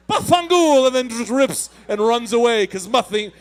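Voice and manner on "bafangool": mock Italian curse. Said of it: "and then he just".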